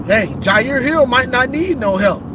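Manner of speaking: ordinary talk, nothing marked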